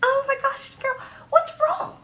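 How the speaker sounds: very high, soft put-on voice; high-pitched hushed "what's wrong"; mock concern. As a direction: imitate someone fussing, high and quiet. Acted quote of a concerned character.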